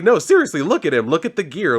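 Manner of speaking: high, loud, urgent retelling; insistent mock alarm. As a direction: act out an excited henchman insisting. Acted henchman insisting it's a ninja.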